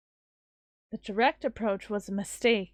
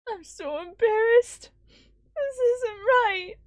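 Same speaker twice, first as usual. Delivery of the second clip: higher, slow, sobbing through the words; breaking down in tears; crying; 9 semitones higher, slower than usual. Acted. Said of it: "to snuff out her sobs".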